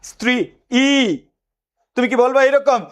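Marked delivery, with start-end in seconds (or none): 0.0-1.2 স্ত্রী, then a separate long ই; separately sounded vowel. Shown as a demonstration. Teacher showing an absurd reading, ই pronounced separately. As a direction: detach and draw out an extra ই after স্ত্রী. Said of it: "ই কি আলাদা উচ্চারণ করবা".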